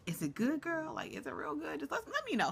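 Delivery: high, soft, nosy teasing; teasing hush. Pretend. Vlogger teasing the girlfriend, high and hushed. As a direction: lean in and ask it like juicy gossip. Teasing a cast member about her man.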